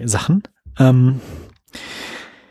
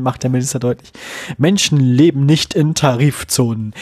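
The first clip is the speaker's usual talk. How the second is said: ordinary talk, nothing marked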